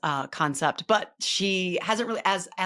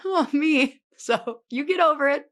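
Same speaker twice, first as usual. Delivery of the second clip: ordinary talk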